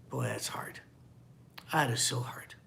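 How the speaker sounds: hushed whisper to himself; whispered aside. Real, not acted. Preacher whispering to the congregation. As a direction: breathe it out as a private aside. Pastor reacts to hard verse.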